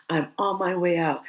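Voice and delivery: ordinary talk, nothing marked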